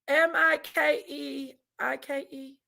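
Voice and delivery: ordinary delivery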